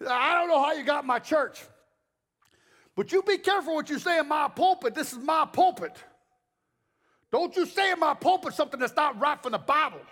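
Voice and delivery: high, loud territorial warning; stern pastor. Retold or imitated. Old pastor warning a guest about his pulpit, stern and loud. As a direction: lay down the law loudly. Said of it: "He introduced me this way".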